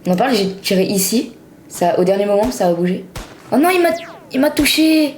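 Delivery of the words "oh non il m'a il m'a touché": dismayed cry. Real, not acted. Got hit in game.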